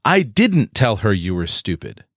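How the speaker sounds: "didn't" hit hard and held a little; stress on didn't. Shown as a demonstration. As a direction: deny it firmly by punching "didn't". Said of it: "I emphatically did not do this".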